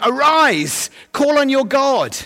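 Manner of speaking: high, loud, declaimed summons; mariner's urgent command. Acted. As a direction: call it out high like a ship's captain rousing a sleeper. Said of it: "sea mariner. And he's saying".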